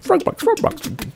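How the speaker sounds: very high, slow sing-song presenter; kids' TV voice. Shown as a demonstration. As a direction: chant it like a kids' show. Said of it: "It sounds like a kid's program".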